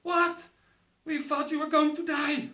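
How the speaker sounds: high, loud mock mother's disbelief; shocked mother. Mother reacts to her son alive, high loud shocked voice. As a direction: play the stunned, frightened mother. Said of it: "my mother is like".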